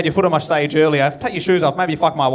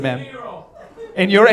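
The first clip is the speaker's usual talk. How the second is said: ordinary delivery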